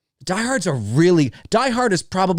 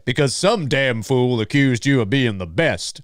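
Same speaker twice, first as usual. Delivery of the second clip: slow, put-on boxer's line; hammed-up film-character quote; Apollo Creed; 2 semitones lower than usual. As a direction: deliver it as a drawn-out movie taunt. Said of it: "sounds like something that Apollo would say".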